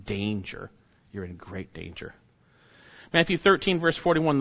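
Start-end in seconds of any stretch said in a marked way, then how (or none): 1.1-2.1 much softer, leaning into the warning; hushed repeat. Real, not acted. Preacher repeating the warning to his flock, hushed and grave. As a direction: drop to a near hush to let it sink in.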